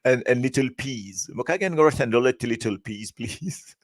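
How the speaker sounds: créole mauricien truffé d'anglais, pour rire; mock English-mixed Creole. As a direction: parodie légère d'un Mauricien anglicisé. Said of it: "je vais pas dire ça comme ça en anglais". (créole mâtiné d'accent anglais, en se moquant gentiment)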